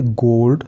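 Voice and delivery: गोल्ड with lips pushed round on the O. Shown as a demonstration. Teacher showing students a round-lipped O. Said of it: "होंठों को ज़्यादा गोल करना पड़ता है".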